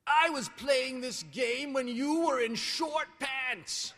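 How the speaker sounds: put-on slow, loud grumpy old man voice; back in my day rant. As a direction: scold like a cranky grandpa, laughing through it. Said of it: "in my very best grumpy old man voice".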